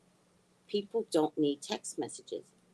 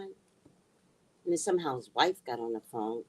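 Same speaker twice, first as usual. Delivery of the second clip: ordinary delivery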